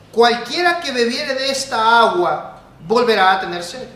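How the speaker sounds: slow, loud, high bible recitation; solemn scripture reading. Acted. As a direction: proclaim the verse over the music.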